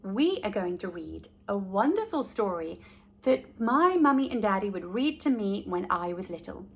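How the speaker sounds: usual speaking voice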